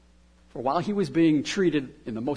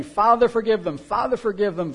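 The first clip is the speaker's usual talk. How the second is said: louder, higher repeated prayer; raised plea; 5 semitones higher, 7 dB louder than usual. Retold or imitated. Jesus praying repeatedly on the cross, raised voice.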